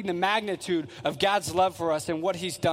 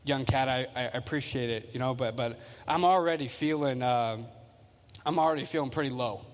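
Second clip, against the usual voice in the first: weary listener; 7 semitones lower, 4 dB quieter than usual. Retold or imitated. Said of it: "you're like".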